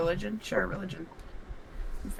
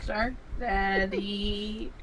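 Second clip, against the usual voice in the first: slow drawl; 4 semitones lower, 10 dB quieter, much slower than usual. Pretend. Drawn-out joke echo.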